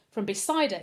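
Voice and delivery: usual speaking voice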